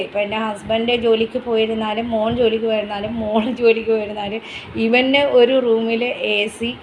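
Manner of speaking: ordinary delivery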